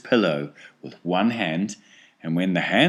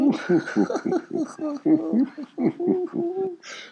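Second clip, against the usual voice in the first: high nervous giggle; nervous giggling. A narrator's reading. Grandpa Joe and Charlie giggle nervously together. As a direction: giggle with jittery anticipation. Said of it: "both started giggling nervously".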